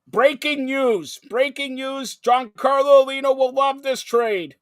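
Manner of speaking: breaking news announcer. Acted. Host announces a fake trade.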